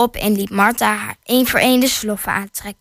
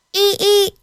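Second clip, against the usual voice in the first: high-pitched imitated creak; squeaking gate; 10 semitones higher than usual. A narrator's reading. Gate creaks as Martha opens it, high squeak. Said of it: "piepte de poort".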